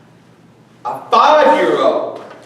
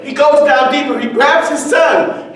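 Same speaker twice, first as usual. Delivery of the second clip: ordinary delivery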